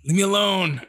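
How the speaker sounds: ordinary talk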